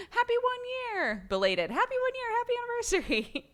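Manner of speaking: high, laughing anniversary cheer; excited wishes. Real, not acted. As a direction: gush over the anniversary.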